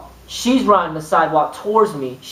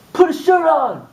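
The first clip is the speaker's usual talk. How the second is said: imitating a man yelling from across the street, much higher; mock shout across the street, pitched way up; retold yell; 9 semitones higher than usual. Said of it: "some guy just screams up".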